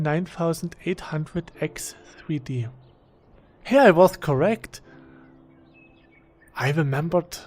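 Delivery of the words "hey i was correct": excited. Real, not acted. Proved right about his CPU.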